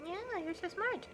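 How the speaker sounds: high, slow praise for a pet. Real, not acted. Owner praising her bilingual cat, high cooing baby talk. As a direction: coo it up high like praising a kitten.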